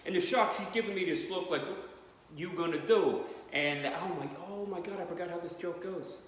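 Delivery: lightly done Brooklyn Italian fisherman telling his story; Brooklyn Italian guy. Retold or imitated. Comedian imitating the Brooklyn Italian shark survivor, Brooklyn accent. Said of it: "I'm sure the guy's like".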